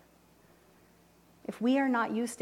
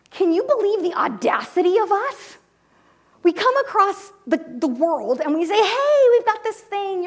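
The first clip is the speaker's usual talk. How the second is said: higher, louder, amazed rush; excited disbelief; 9 semitones higher, 10 dB louder than usual. Retold or imitated. Telling Tina about their audacity, excited and loud. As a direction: marvel out loud at the nerve of it. Excitedly retelling talk with wife.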